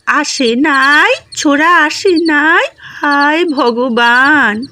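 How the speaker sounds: put-on very slow, shaky old man's cry; old father calling out from the house, slow and trembling; quavering call. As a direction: draw it out like a frail old man. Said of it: "কাঁপা কাঁপা গলায় হাঁকে".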